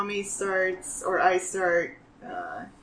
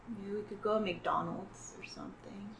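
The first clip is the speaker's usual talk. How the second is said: hushed whisper, barely voiced; 15 dB quieter, faster than usual. Kid whispers to brother about McDonald's, hushed. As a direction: whisper it so parents won't hear. Hungry kids plotting food quietly.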